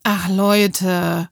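mild, sighing dismay; gently disappointed, as if shaking the head; understated weary reproach; a little sad. A narrator's reading. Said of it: "ein bisschen traurig den Kopf geschüttelt".

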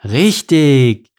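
loud, delighted exclamation; triumphant shout of approval; jubilant. A narrator's reading. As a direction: cheer it out, drawn long. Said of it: "jubelte der Papa".

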